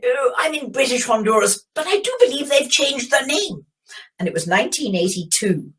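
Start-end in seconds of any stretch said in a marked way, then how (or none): 0.0-3.7 lightly mocked proper English accent, pitched higher. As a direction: imitate the posh English caller, a bit higher. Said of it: "very proper English accent".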